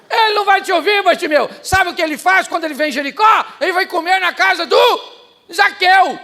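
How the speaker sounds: high, loud mocking shout at the blind man; mocking crowd. The crowd taunting Bartimeu, loud mocking jeer. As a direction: jeer down at him, laughing.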